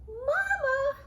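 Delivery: high eager call to mother; excited child calling. Acted. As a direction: call out to Mama, thrilled.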